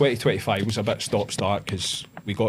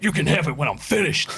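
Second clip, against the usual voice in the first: dismissive gym guy; 7 semitones higher, 3 dB louder than usual. Retold or imitated. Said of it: "He was just like".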